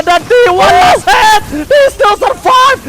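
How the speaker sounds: high, slow, shouted hype; excited shout. Real, not acted. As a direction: yell it like a big play. Caster shouting at a big play.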